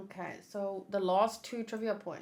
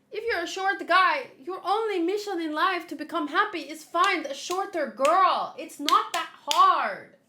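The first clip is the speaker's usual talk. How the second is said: loud, high joking exasperation; playful raised-voice lecture; mock rant; 6 semitones higher, 6 dB louder than usual. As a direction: rant in jest, louder and higher, dragging out the last line. Mock rant, then "I'm kidding.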